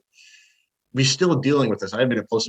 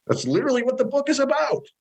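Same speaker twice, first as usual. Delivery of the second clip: exasperated; 14 semitones higher than usual. Real, not acted. Exasperated point against the purists.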